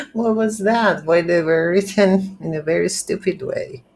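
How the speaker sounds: laugh, then ask it high, still amused; laughing in disbelief. Real, not acted. Developer reacting to his game's stupidly written lines, laughing.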